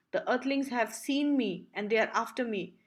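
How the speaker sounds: ordinary delivery, nothing marked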